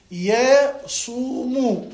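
يصوم broken slowly into ya-suu-mu; syllable by syllable. Shown as a demonstration. Teacher asking the student to watch closely, يصوم slowly syllable by syllable. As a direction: sound out يصوم in three drawn-out syllables. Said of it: "شوي انتبه لي".

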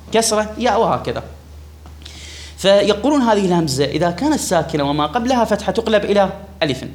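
ordinary talk, nothing marked

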